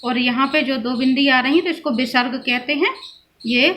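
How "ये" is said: ordinary talk, nothing marked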